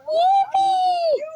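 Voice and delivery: a sudden high, happy squeal; excited yelp. Real, not acted. YouTuber kicking off her story time, squealing with glee. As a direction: burst out with a gleeful cheer. Celebrating starting the video.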